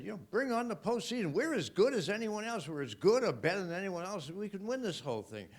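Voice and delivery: rally the fans like a convert; retold excitement. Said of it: "And I said".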